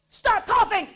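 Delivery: loud, high yell across the store. Retold or imitated. Wife yelling at husband across Walmart aisles, loud high yell. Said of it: "she very lovingly just said".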